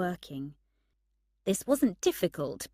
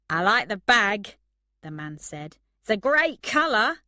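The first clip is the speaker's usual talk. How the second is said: mocking; 3 semitones higher, 8 dB louder than usual. A narrator's reading. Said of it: "He laughed mockingly".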